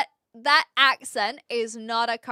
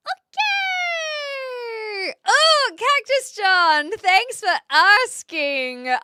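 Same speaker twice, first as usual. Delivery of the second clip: excited greeting; 10 semitones higher, 6 dB louder, slower than usual. Real, not acted. Greets a viewer's question.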